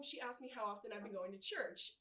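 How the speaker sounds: usual speaking voice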